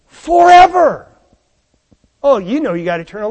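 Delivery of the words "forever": forever lifted high, louder and slow; preacher swelling on the last word of the verse; ringing preached climax. Acted. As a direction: let forever soar over the congregation.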